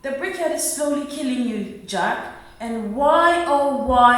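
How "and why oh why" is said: louder, pleading why oh why; exasperated plea to her husband; pleading outburst. Acted. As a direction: cry out the question at him.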